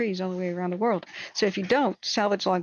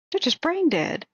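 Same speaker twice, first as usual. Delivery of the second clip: angry; 8 semitones higher, 4 dB louder than usual. Real, not acted. Angry rant about court ruling.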